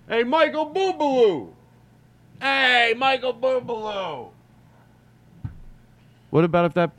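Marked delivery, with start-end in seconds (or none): 0.1-4.3 put-on high, slow drunken call to the stage; drunk yelling out. Drunk fan at a show yelling to the singer, high and drawn out. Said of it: "you start yelling out".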